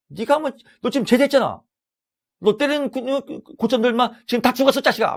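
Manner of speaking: very high, loud scolding; acted angry tirade. Counselor snapping a veteran out of it, high shouted mock tirade. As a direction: yell it in mock outrage, stumbling over the words. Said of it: "막 이렇게".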